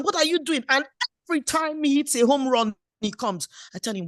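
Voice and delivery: ordinary delivery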